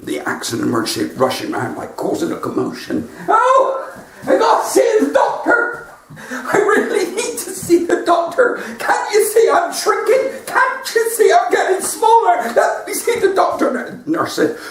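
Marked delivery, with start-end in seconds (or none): 3.3-13.8 high squeaky panicking voice; panicky shrinking man. Retold or imitated. Shrinking patient begging the nurse, high squeak. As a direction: squeal like a man getting smaller.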